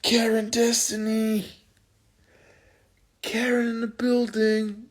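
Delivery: high, slow, drawn-out mock announcer call; sing-song Karen call. Greeting a fan named Karen in a high mock hype voice. Playing with a fan's name.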